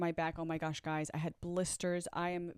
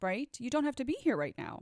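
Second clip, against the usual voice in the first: imitating an angry self-talk, higher pitched; sassy mock telling-off, quick and higher; angry self-scolding; 5 semitones higher, 4 dB louder than usual. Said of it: "I would also get angry at myself".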